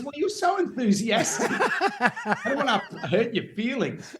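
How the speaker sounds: high, laughing mock sympathy; laughing brother. Brother laughing as he breaks the news. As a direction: let the brother laugh through the letdown. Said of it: "And he goes".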